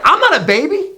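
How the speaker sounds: put-on high, indignant little-boy voice; mock toddler protest, high and emphatic; flipping-out toddler. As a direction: imitate an offended two-year-old. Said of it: "He flips out".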